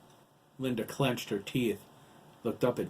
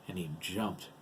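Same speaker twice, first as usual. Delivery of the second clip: plain reading voice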